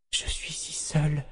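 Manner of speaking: unvoiced, trembling whisper of loneliness; terrified whisper. A narrator's reading. Kalan lost among the dead, whispering in fear.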